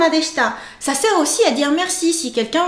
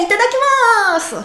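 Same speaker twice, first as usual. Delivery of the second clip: bright lifted itadakimasu, higher and louder; cheerful itadakimasu; 8 semitones higher, 4 dB louder than usual. Shown as a demonstration. Teacher demonstrating a cheerful variant, higher and louder. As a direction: toss off a happy itadakimasu. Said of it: "Ou bien, yeah".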